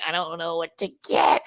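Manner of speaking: high mock whine of indecision; indecisive. Voicing own Libra side over breakfast, indecisive. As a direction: act out dithering over a choice. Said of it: "my Libra kicking in going".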